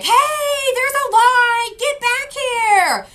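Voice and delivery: high, drawn-out mock holler; slow, high-pitched retold shout; imitated crowd yelling. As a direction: call out like angry people far back in line. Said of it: "screaming and yelling".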